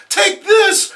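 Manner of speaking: very high, loud shrieking woman; shrill villainess. Acted. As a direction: screech it as you attack. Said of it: "I should have been doing that voice".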